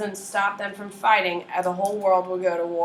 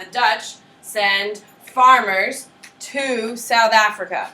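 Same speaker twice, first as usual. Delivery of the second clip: very slow, drawn-out and louder; slower dictation; 7 dB louder, slower than usual. Shown as a demonstration. Teacher repeating the point slowly for note-takers. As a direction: stretch every word so they can write. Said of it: "I'd write that down".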